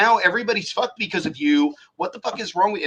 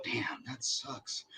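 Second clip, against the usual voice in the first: slow, much softer, unbothered; calm acceptance; 4 semitones lower, 15 dB quieter, much slower than usual. Retold or imitated. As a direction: let it go gently, no anger. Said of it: "I go".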